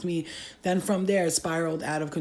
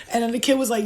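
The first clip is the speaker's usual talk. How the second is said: normal talking voice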